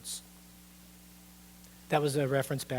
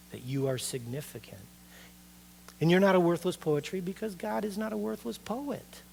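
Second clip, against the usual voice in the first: usual speaking voice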